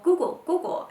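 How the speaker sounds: put-on high, loud little-kid chirp; toddler voice. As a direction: imitate a toddler repeating a new word. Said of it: "he'll go".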